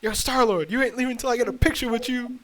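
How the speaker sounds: loud slurring street shout; drunk yelling. Retold or imitated. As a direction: yell across the street like a drunk. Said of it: "started yelling at us".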